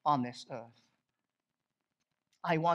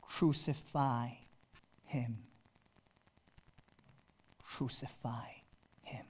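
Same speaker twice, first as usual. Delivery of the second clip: softer each time until only a whisper is left; crucify fading to whisper; 6 semitones lower, 10 dB quieter than usual. Acted. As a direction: let the crowd's cry die away into a whisper. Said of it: "they would cry out".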